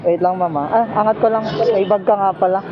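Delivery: ordinary talk, nothing marked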